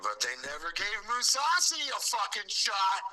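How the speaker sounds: rising, high-pitched indignation; indignant outburst. Real, not acted. Fan griping that Mousasi was never given a shot, indignant. Indignant rant over a denied fight.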